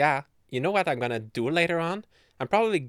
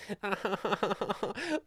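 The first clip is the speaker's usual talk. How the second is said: high, drawn-out mock laugh; put-on taunting cackle; imitated gloating laugh; 8 semitones higher, 5 dB quieter than usual. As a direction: laugh like you got the longer holiday. Imitates college students gloating.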